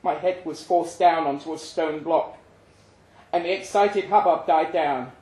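ordinary delivery, nothing marked

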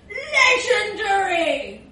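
loud, high, drawn-out whoop; boastful cheer. Acted. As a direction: crow it proudly, stretching the word. Bragging about the stag party.

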